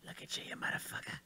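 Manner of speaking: hushed, whispered movie-gangster line; whispered impression. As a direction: whisper the impression under your breath. Repeats his Pesci impression under breath.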